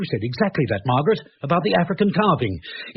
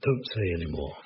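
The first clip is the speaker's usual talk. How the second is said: hushed, pained; 9 semitones lower, 9 dB quieter than usual. Acted. Wife dissects him, he breaks.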